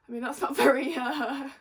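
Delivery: loud tipsy giggle. Real, not acted. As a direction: laugh through the words, tipsy. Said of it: "Me and my words when I've had a drink".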